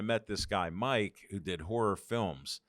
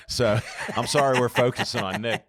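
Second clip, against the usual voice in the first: laughing; 9 dB louder than usual. Real, not acted. Apologizing while still laughing.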